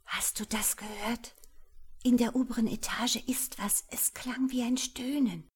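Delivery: high, breathy anxious whisper; frightened whisper. Acted. Wife whispers her fears to her husband, high and anxious. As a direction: hush him, scared of being heard. Scared whisper about a moan.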